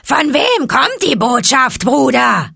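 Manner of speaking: high, slow, loud call from above; a bird calling down to the boy; vulture voice. Acted. Vulture calling down to Mowgli, high and slow.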